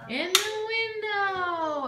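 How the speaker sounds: high, slow, drawn-out echo; sing-song praise echo. Acted. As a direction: repeat it like praising a toddler. Mother echoes, high, slow.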